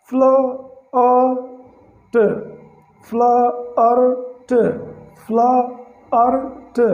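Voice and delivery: फ्लर्ट said very slowly with a long open "aa" vowel, plaart; drawn-out flurt. Shown as a demonstration. Teacher slowly modelling फ्लर्ट for students. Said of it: "आई का हो गया आ".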